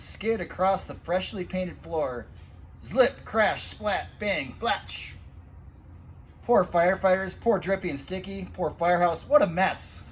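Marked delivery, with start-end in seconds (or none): none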